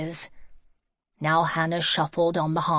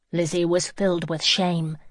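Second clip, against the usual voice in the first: ordinary delivery, nothing marked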